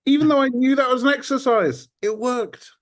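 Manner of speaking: real high-pitched, loud burst of delight; thrilled, pitch shooting way up; elated. Real, not acted. Coached man reacting to praise that worked on him, elated. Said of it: "I feel good".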